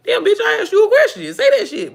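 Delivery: loud, very high comic rant; playful indignant outburst; mock outrage. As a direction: demand it with hammed-up annoyance, near laughing. Acts out annoyed question-asker.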